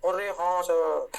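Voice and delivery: slow, drawn-out student reply; student chorus. Acted. Teacher voices the class agreeing, slow student chorus. Acts the class answering him.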